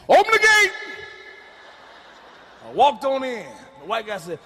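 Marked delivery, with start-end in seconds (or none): 0.1-0.7 high, loud order to open up; God calls out loud to the gatekeeper; loud command. Retold or imitated. God bellowing, high and loud.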